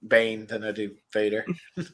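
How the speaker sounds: ordinary delivery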